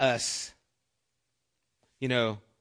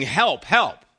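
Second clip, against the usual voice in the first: a lightly raised plea, help help; mock cry for help; 5 dB louder than usual. Shown as a demonstration. Pastor demonstrating an exclamation, mild cry for help. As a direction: call out for help, half-acted. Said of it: "It's like saying".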